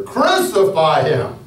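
the mob roaring for crucifixion. A narrator's reading. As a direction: roar it like an angry mob. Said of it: "the mob roared even louder".

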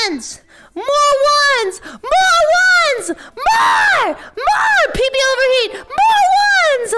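very high, drawn-out loud yell; hammed-up screaming demand; demanding shout. Streamer urging chat to farm ones, high shouted demand.

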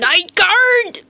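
high, loud proud exclaim; excited night guard exclaim. Real, not acted. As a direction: announce it with glee. Excited echo of role.

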